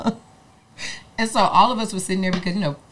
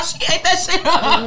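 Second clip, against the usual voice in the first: high, slow laughing praise; 11 semitones higher, 5 dB louder than usual. Real, not acted. Laughing while praising her friend's dance. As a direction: laugh it out with delight.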